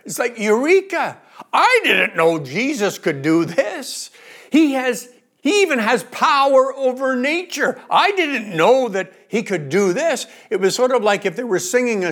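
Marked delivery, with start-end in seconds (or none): 0.4-10.3 eureka excitement. Retold or imitated. Disciples realizing Jesus' power over nature, excited eureka. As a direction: burst out with sudden realization. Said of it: "a revelation moment here".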